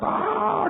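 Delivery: high cartoon yell; falling scream. Ball screaming as it falls, high. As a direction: shriek while dropping. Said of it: "bola ini teriak".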